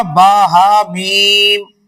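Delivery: very slow, drawn-out "با ہا میم"; teacher's model "با ہا میم"; slow spelling. Shown as a demonstration. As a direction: model "با ہا میم" slowly and clearly. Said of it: "کیا پڑھنا ہے".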